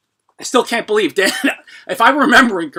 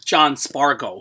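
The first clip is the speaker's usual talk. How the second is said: normal talking voice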